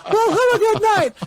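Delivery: very high, loud, laughing send-off; Jim Carrey style goofy. Retold or imitated. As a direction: fling it out like Jim Carrey collapsing. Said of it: "fall on my back like Jim Carrey".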